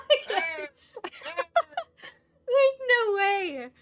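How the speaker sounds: laughing through the words; can't stop giggling. Real, not acted.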